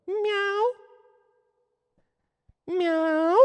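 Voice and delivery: high drawn-out cat meows; squeaky-high imitation cat. Shown as a demonstration. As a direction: meow like a little cat, way up high. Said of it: "You have to go".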